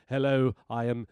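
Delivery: low South African professor impression; South African accent. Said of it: "South African accent".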